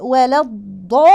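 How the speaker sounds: slow "ولا الضا", daad held before release; elongated daad. Shown as a demonstration. Teacher demonstrates the daad for learners, slow and heavy. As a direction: press and hold a heavy "ض". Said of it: "الضاد هنا لازم أخلي لها".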